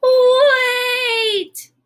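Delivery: very high, loud, drawn-out "Wait!"; big alarmed shout. Acted. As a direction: cry out as if disaster looms. Gerald halts the drive again.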